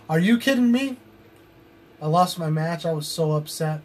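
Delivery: normal talking voice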